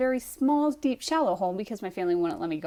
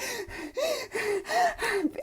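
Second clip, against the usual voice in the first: rapid, high-pitched gasps; mock hyperventilating; 13 semitones higher, 6 dB quieter than usual. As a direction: act out frantic, gasping overbreathing. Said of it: "I've never hyperventilated ever".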